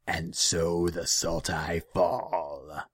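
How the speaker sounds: loud, very slow demonic pronouncement; heavy, menacing creature voice; ominous demon. A narrator's reading. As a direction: draw it out like a curse from the shadows. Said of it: "Kudal's voice seemed to come from the shadows".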